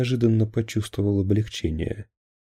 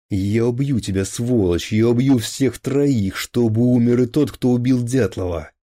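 louder and higher, slowing on троих; furious threat; 4 semitones higher, 5 dB louder than usual. Acted. Enraged captain threatens to kill all three officers. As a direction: spit out the death threat.

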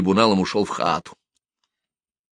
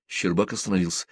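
plain reading voice

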